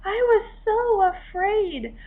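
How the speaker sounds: high, teary, slowing thanks; tearful little crab. A narrator's reading. Rescued hermit crab tearfully praising Henry's courage. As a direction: let relief break into tears. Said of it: "she said".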